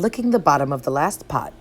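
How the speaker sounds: plain reading voice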